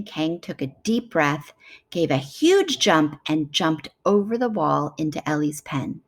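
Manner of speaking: usual speaking voice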